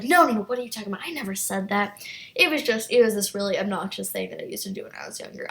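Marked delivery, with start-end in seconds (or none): 0.0-2.0 quick mock-innocent backpedal; hurried fake denial, as a bratty kid; fast backpedaling denial. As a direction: rattle it off fast, playing innocent. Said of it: "then I would be like".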